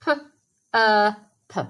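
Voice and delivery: P-er-p sounded out sound by sound; segmenting phonemes. Shown as a demonstration. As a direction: say P-er-p slowly, one phoneme at a time. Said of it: "Perp is the first syllable".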